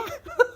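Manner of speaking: a quick high squeal of laughter; embarrassed giggle. Real, not acted. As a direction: crack up in one high burst. Laughs at her own exposure.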